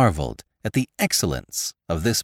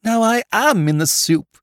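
moaning; 13 semitones higher, 3 dB louder than usual. Acted. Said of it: "he moaned".

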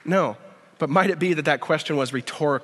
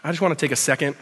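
soft, lower, unhurried prayer voice; quiet prayer; 4 semitones lower, 4 dB quieter than usual. Acted. Pastor praying over congregation, quiet and earnest. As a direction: pray gently over the room.

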